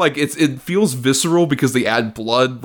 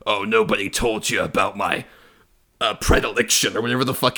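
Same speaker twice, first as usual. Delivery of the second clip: villain monologue; 3 semitones lower than usual. Retold or imitated. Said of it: "monologuing evil".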